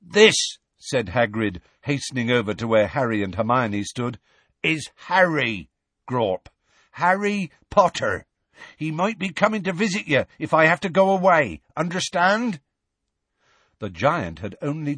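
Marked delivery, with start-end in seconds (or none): 0.0-0.6 raised, higher character voice; Hagrid introducing. A narrator's reading. Hagrid calls up to giant. 4.6-12.6 higher-pitched, insistent character voice; Hagrid introducing. A narrator's reading. Hagrid introduces Harry to giant.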